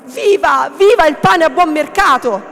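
loud, high rallying cry; shouted cheer. A narrator's reading. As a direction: shout it like a man in a crowd. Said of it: "uno gridò". (grido di evviva, forte e acuto)

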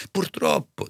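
high servant voice; rueful housekeeper. A narrator's reading. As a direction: agree ruefully, pitched high. (serva dispiaciuta, voce acuta)

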